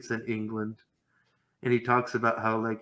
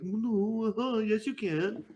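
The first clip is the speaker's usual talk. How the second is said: higher mock sing-song dismissal; mocking leftists; 6 semitones higher than usual. Mocking the leftists who deny it, higher sneering tone. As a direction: sneer it like a condescending lefty. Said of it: "they'll tell you".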